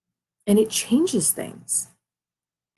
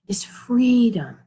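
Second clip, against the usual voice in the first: whispered; 5 semitones higher, slower than usual. Real, not acted. Savouring the feeling of freedom.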